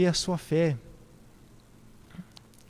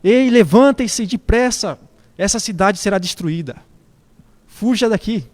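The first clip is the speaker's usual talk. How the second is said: loud, higher urgent warning cry; grito de alerta; 3 semitones higher, 6 dB louder than usual. Retold or imitated. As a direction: call out loudly to rouse a sleeping household. (grito urgente de alerta)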